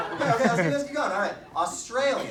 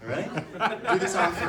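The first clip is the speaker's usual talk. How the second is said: usual speaking voice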